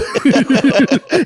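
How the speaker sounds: a real, high laugh bursting out; high burst of laughter. Real, not acted. Host laughing hard at his own story. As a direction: crack up at the story.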